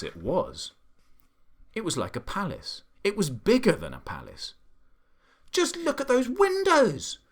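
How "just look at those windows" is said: very high, loud monkey voice; monkey's excited cry. A narrator's reading. Monkey marvels at the palace windows, excited high cry. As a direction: squeal it in high-pitched delight. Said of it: "cried the monkey".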